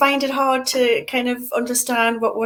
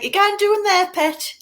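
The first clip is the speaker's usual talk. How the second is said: put-on playful Geordie accent, higher and louder; jokey Geordie street voice; mock Geordie; 9 semitones higher, 6 dB louder than usual. Interviewer guessing the man's broad Geordie directions, mock Geordie accent. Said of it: "Was he saying something like".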